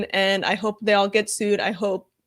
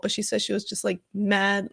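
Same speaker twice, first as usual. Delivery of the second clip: ordinary delivery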